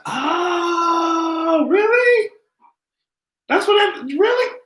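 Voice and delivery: squealed high oh really; high-pitched disbelief; shocked squeal at the news; real surprise. Real, not acted. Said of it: "surprised reaction to casting news".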